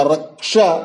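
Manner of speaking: रक्षा drawn out as "rak-sha"; correct pronunciation. Shown as a demonstration. Teacher naming the pure word, रक्षा as "raksha". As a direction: say रक्षा slowly with a clean ksh. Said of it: "शुद्ध शब्द होगा".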